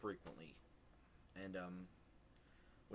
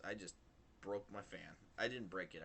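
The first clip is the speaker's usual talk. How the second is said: normal talking voice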